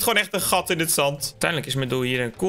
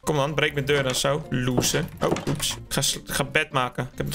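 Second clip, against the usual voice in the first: normal talking voice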